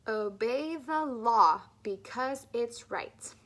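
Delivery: "love life" with slow, clear L; slow, careful speech-therapy model; slow clear L drill. Shown as a demonstration. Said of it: "Keep thinking about that tongue".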